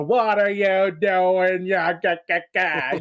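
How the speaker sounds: slow mock gangster; little cartoon gangster. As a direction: stretch it out like a Looney Tunes hoodlum. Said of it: "little gangster guys are like".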